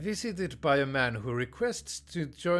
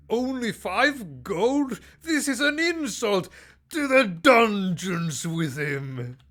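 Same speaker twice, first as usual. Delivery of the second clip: high, loud, slow hammed-up indignation; mock royal outrage; 8 semitones higher, 7 dB louder, slower than usual. King scorns an apprentice's tiny gift, hammed-up outrage. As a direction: play the offended king bellowing his sentence. King rejects paltry gold offering.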